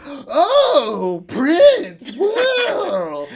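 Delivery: loud, high exaggerated whoops; hammed-up, over-the-top mocking cry; mock amazement. Young employee ridiculing bartender's favorite artist, mock amazement.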